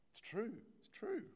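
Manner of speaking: high, eager It's true; excited. Real, not acted. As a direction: affirm it with a jump in pitch.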